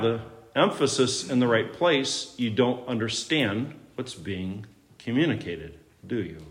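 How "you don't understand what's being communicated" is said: ordinary talk, nothing marked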